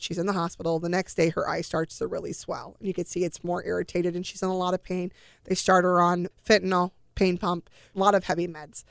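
ordinary delivery

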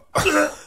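high hammed-up retching cough; mock gag into the toilet; fake retching cough. Shown as a demonstration. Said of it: "and you do that".

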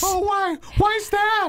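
cruise lady. Pretend. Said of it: "you're gonna pretend to be her".

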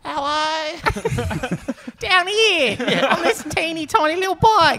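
a tiny biker mouse on a tiny bike, high. Acted. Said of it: "you would've killed it on biker mice".

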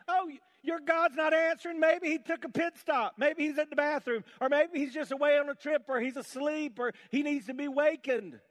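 high, loud sarcastic jeering; mocking. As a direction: rub their noses in the silence. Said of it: "Elijah began mocking them".

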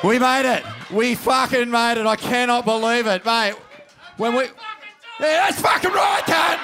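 loud triumphant cheer; excited cheering. Real, not acted. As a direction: celebrate it out loud. Celebrating arrival.

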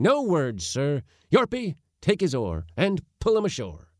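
high, forceful interruption; stern dismissal. A narrator's reading. Uncle ordering his nephew put ashore, stern dismissal. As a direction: cut off all argument.

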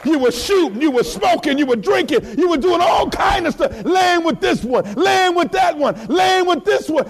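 high, rhythmic shouted list; driving preaching. Real, not acted. Preacher lists the congregation's past sins, high driving shout. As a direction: pile up the phrases in a driving chant.

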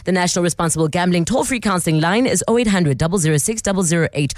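ad read. Real, not acted. Radio host crediting sponsor and giving gambling warning, ad read. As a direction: read out the sponsor's small print smoothly.

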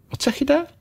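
girl voicing her kitten's reply. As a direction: pretend to be the kitten talking. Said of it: "deed alsof het katje sprak".

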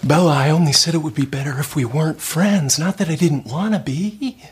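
high, hammed-up brooding vampire lover; Twilight audition. Actor mocking his own audition reading to Bella, hammed-up. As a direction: overact a moody vampire to Bella. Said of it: "reading for Twilight".

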